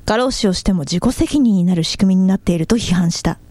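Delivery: steady, neutral news anchor delivery; news read. Shown as a demonstration. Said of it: "シーエムニュース。".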